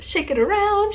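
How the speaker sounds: high sing-song shake it around; squeaky playful chant. Acted. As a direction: sing it out high while shaking hair. Shaking out hair playfully.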